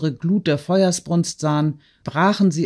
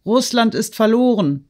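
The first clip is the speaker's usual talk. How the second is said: high, louder cry of despair; despairing shout; 7 semitones higher, 3 dB louder than usual. A narrator's reading. Shopkeeper shouting after Alpatitsch in despair. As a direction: shout it after the departing steward. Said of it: "schrie Ferapontow Alpatitsch nach".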